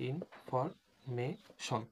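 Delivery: Information very slowly, a gap after each syllable. Shown as a demonstration. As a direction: dictate information piece by piece. Breaks the word up while writing. (Information ভেঙে ভেঙে, খুব ধীরে)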